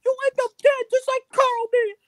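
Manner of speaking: very high, loud little gangster; Mickey Mouse. Acted. Gangster warning that the homies are coming, high mouse voice. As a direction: threaten like a squeaky cartoon gangster. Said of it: "gangster midget with a Mickey Mouse voice".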